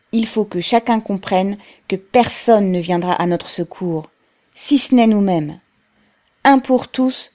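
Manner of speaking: loud, emphatic rallying oratory; rousing speech. A narrator's reading. Pavel calls his comrades to resist, loud and rousing. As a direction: urge the crowd to stand together.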